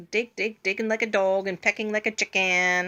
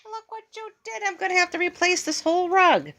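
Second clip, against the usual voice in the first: exasperated scolding; 10 semitones higher than usual. Real, not acted. Scolding bird over rug.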